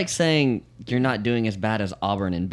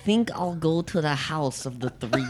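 put-on light Russian accent, higher; a hint of a Russian villain; 5 semitones higher than usual. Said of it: "give us a porridge line, please".

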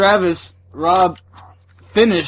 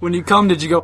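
usual speaking voice

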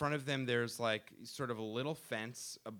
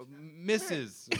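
mild yell; 5 semitones higher than usual. Retold or imitated. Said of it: "I just yelled at the woman".

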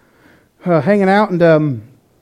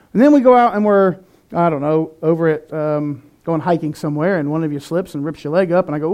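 ordinary delivery

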